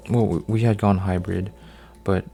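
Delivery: usual speaking voice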